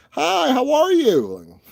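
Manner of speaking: mock corporate chirp, slowed down; HR hello. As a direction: stretch it like a customer service smile. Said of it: "all of a sudden".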